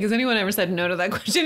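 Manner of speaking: mock the friend's joke with a laugh in it; laughing while quoting. Friend imitates his dad joke at check-in, laughing. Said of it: "You were laughing. You're like".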